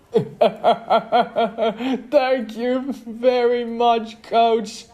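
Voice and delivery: very high, loud, slow jeer; mocking thanks. A narrator's reading. Player mocking the coach's new team, high jeer. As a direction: jeer it slowly, high-pitched.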